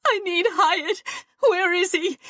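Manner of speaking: Fawn, high and pleading, near tears; desperate plea. A narrator's reading. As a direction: beg for him, voice climbing high.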